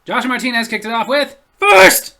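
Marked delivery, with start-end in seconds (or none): 1.6-2.2 high, loud shout of First. Retold or imitated. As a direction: yell it out like a winner. Reading an eager commenter.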